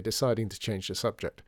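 ordinary delivery

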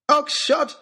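very high loud alarmed shout. Acted. Narrator shouting at baronet raising his gun, loud shout. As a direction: cry out his name to stop him. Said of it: "I shouted".

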